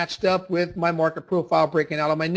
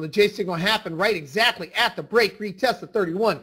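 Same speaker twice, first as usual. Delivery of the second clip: ordinary talk, nothing marked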